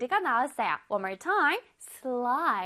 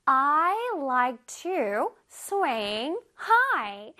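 slow example read; much slower than usual. Shown as a demonstration. Teacher reading example to children, very slow. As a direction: spell out the sentence slowly for kids. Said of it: "One more time".

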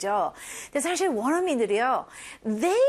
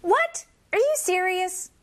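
high, incredulous rising question; astonished disbelief; 6 semitones higher than usual. Shown as a demonstration. Teacher modelling a reaction to surprising sales news, astonished. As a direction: react with high-pitched amazement.

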